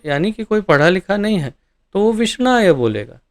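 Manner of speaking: ordinary delivery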